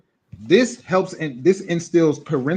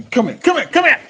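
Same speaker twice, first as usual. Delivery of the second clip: calling someone over; 5 semitones higher, 4 dB louder than usual. Real, not acted.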